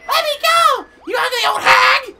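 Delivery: high, loud cartoon cry; captive's shout. Kidnapped game character to the old hag, high shout.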